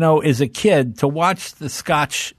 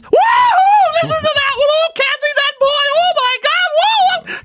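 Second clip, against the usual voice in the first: shrieking high party laughter voice; squealing, loud hooting; whooping women; 4 dB louder than usual. Retold or imitated. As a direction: hoot it up like delighted ladies at a party. Adults laughing at boy's joke.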